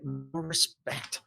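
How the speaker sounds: sulky aside. Acted. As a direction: mutter it like a hurt old coworker. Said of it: "she goes".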